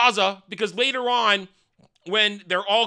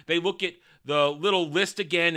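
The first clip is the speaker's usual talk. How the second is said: normal talking voice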